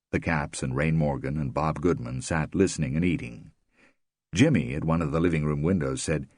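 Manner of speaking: usual speaking voice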